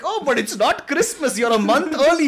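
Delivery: lightly voiced ditzy Miria; playful Miria voice. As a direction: chirp the correction cheerfully.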